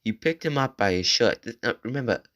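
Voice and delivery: normal talking voice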